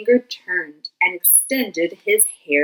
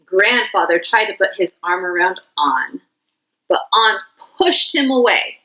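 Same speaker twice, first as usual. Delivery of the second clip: usual speaking voice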